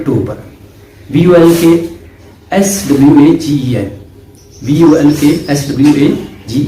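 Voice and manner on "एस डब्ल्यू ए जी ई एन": ordinary talk, nothing marked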